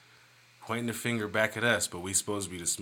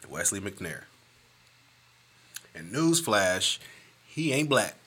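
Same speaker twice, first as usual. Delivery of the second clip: ordinary talk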